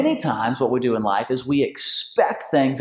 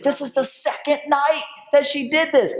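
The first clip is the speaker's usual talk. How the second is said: put-on high, indignant little boy's complaint; high, outraged young son; upset boy; 13 semitones higher than usual. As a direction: whine it like a wronged kid. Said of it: "He's like".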